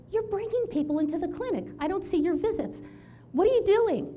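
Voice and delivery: a lightly voiced irate boss; mock boss scolding. Boss scolds her about clinic visits, mock angry.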